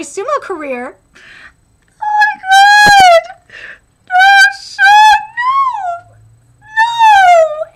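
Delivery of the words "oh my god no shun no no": high, loud, slow hammed-up crying; mock anguished wail. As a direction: wail 'no' like your heart is breaking. Reacting to friend's retirement.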